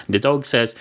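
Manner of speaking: ordinary delivery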